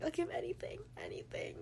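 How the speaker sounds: soft, high plea fading to a breath; retold begging. Heroine offers anything for his life, retold desperate begging. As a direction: imitate desperate bargaining, trailing into a whisper. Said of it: "like, begging".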